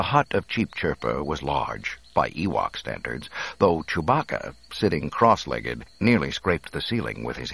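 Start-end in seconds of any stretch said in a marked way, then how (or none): none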